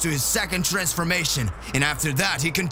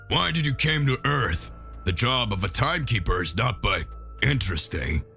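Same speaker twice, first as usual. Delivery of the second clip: Piccolo voice, lower; 7 semitones lower than usual. Acted. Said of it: "Piccolo asks Trunks".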